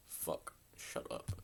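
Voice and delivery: low, slow whisper to your friend; whispered warning between friends; hushed whisper. Retold or imitated. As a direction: hiss it so the guy won't hear. Hiding from the angry dude.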